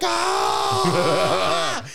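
long high-pitched celebratory scream; yelling. Retold or imitated. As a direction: scream wordlessly like a fan whose team just won. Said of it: "yelling and yelling and yelling".

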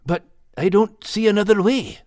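high Scottish dog voice; resigned, to himself; Max to himself. A narrator's reading. Max convincing himself, high voice. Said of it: "Max said to himself".

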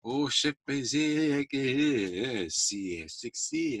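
slow, drawn-out French purr; very slow, swooning French lady voice. Retold or imitated. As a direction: stretch it out like a smitten Parisian. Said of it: "the ladies were like".

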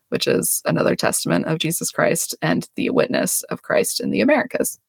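ordinary talk, nothing marked

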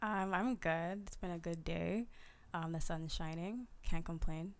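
shy guest speaking softly into the mic; soft-spoken. Real, not acted. Guest answering the podcast host, soft and quiet. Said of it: "I really need you to project your voice".

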